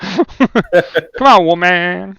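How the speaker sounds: high, loud "Come on, woman" through laughter; teasing, laughing exasperation; laughing mock complaint. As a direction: giggle the variant out playfully. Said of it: "I don't know if that one works".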